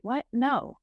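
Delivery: normal talking voice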